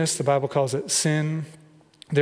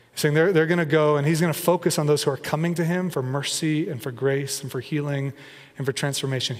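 normal talking voice

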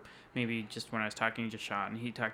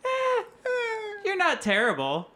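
high-pitched reluctant squeak; grudging, much higher hedging; hesitant wife; 5 dB louder than usual. Retold or imitated. Wife sparing her husband's feelings, hesitant. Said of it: "She's like".

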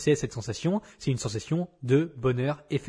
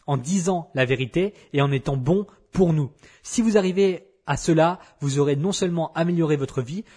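ordinary talk, nothing marked

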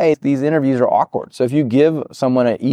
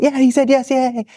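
much higher, hammed-up delight; mock giddy cheer. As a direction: squeal it like a thrilled kid getting the answer he wanted. PM celebrating in his head.

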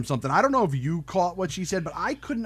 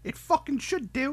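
higher lightly voiced thug; quoting the torturer; 11 semitones higher, 3 dB quieter than usual. Friend repeating the torturer's catchphrase, lightly voiced. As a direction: mimic the torturer's line with relish. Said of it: "friend quotes torturer".

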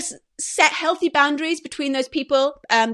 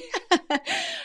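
teary laugh; 6 dB quieter than usual. Real, not acted. Laugh after crying.

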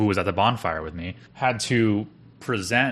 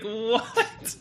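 high-pitched mock-stunned "what"; retold disbelief; 14 semitones higher than usual. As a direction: act out giddy shock through a laugh. Retelling stunned reaction to bonus.

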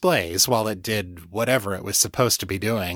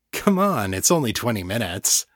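ordinary delivery, nothing marked

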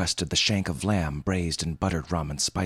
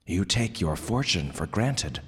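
usual speaking voice

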